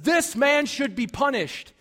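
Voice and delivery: a furious king calls for punishment; furious David. Retold or imitated. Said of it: "David is furious and says".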